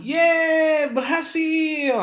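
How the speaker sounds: high, loud cheer of success; happy shout. Shown as a demonstration. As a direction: cheer out loud, stretching the words. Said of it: "berteriak karena senang". (berteriak senang dengan nada tinggi)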